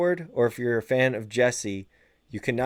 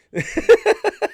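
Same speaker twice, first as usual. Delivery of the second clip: big laugh; 9 dB louder than usual. Real, not acted. Host laughs at calling the album pretty good, loud high laugh.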